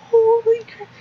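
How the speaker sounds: high and loud astonished exclaim; shocked holy crap. Real, not acted. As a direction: gasp it out in disbelief.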